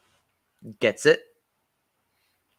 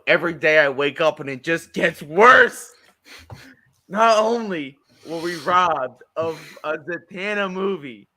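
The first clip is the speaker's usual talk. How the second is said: mock despair; 7 semitones higher, 8 dB louder than usual. Mock outrage over cancelled film.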